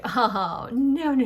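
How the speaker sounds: loud, hammed-up dismissal; mock refusal. Writers turning down a backstory as too mild, mock refusal. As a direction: wave the idea away loudly, in mock protest.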